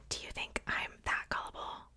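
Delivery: normal talking voice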